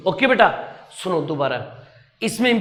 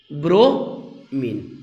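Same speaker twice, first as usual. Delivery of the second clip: slow emphasis; 5 semitones lower, much slower than usual. Shown as a demonstration. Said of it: "दोनों याद कर लीजिएगा".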